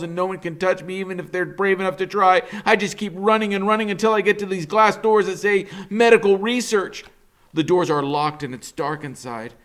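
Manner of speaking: plain reading voice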